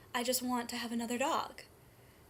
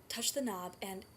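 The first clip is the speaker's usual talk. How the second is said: normal talking voice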